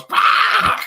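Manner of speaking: squawky cartoon duck curse; Donald Duck impression. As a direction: yell it like an angry cartoon duck. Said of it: "that's my Donald Duck impression".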